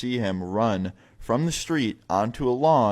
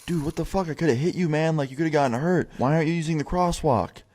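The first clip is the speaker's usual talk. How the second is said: imitating a friend's higher-pitched, incredulous scolding; mock alarmed telling-off, pitched up; 6 semitones higher than usual. Friend to man he nearly hit with the car, alarmed scolding.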